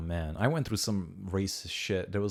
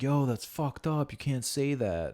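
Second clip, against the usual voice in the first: higher, drawn-out complaint; mock protest; 6 semitones higher, slower than usual. People complaining about [name], mock protest. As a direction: imitate people objecting, slowly. Mimics outraged listeners' protest.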